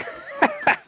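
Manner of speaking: high, breathless squeal of a laugh; giddy high laugh. Acted. Lineback dreaming of gold, giddy high laugh. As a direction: giggle with greedy delight.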